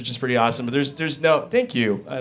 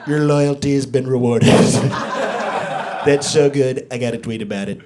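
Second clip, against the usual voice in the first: Trump impression; 4 semitones lower than usual. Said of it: "our current president, he'd be like".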